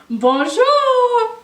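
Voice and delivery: high, loud sing-song hello; cheerful lion hello. Acted. Lion greeting Monsieur Dupont, high and loud. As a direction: call it out happily across the street.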